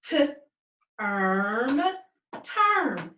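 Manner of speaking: segment slowly T, then erm, then blend into term; sounding out a word. Shown as a demonstration. Teacher to class, sounds out term slowly.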